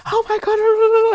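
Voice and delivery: high, slow, stretched-out gasp of disbelief; freaking out. Retold or imitated. First day at Berkeley, starstruck by heroes, high slow squeal. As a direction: squeal it in awe. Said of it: "I'm literally freaking out".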